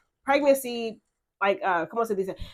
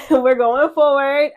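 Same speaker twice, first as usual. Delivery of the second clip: laughing happily; 3 semitones higher, 7 dB louder, slower than usual. Real, not acted. Sharing her good news.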